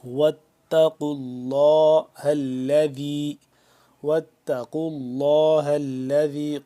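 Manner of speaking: اللہ with a full, thick لام; heavy Allah. Shown as a demonstration. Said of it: "لفظ اللہ کو بھی پر کر کے پڑھیں گے".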